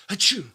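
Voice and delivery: a loud, high fake sneeze; mock sneeze. Grandpa walking in, mock sneeze. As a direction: burst out a hammed-up achoo. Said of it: "he'd come in and be like".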